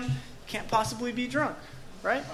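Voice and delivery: ordinary talk, nothing marked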